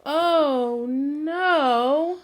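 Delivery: big storybook "oh no"; loud mock alarm. Mother calls out oh no for the children. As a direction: play up the alarm for little ones. Reading to preschoolers.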